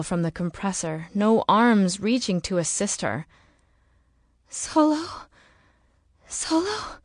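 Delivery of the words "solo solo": anxious calling. Acted. Searching for unconscious partner.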